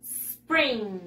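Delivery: SPRING starting on a long hissed S, no vowel before it; pure initial S. Shown as a demonstration. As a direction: stretch the S of "spring", "sss-pring". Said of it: "solamente la S". (solo la S inicial, alargada)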